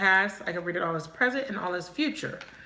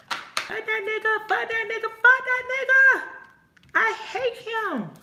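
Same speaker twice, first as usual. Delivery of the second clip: much higher, shrill-pitched hammed-up fury; mock furious breakup rant; 13 semitones higher, 4 dB louder than usual. Acting out the client's angry denial, hammed up. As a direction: overplay the girl swearing she hates him. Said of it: "I don't feel like you hate him".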